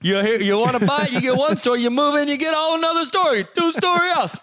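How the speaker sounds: high, pushed stand-up comic voice; Rodney Dangerfield. Acted. Co-host doing a two-story house bit, comedian impression. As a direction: work the crowd with a rising, punchy comic routine. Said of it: "Rodney Dangerfield".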